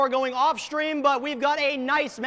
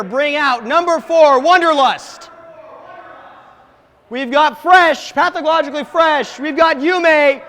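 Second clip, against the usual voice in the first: booming arena introduction, drawn out; hype announcer; 4 semitones higher, 7 dB louder than usual. Real, not acted. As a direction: sell each name to the crowd. Introduces the team on stage.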